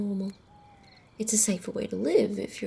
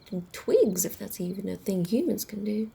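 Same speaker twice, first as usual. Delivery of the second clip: ordinary delivery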